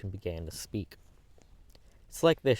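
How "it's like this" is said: higher, louder start with a slight quiver; lightly voiced quivering girl; weak and quivering. A narrator's reading. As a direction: tremble as she begins, gathering courage. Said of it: "in a weak and quivering voice".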